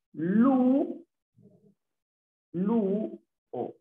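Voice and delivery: "lu" long and high, "o" apart; syllable by syllable. Shown as a demonstration. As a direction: sound out "lu" and "o" separately. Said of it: "Se separa así".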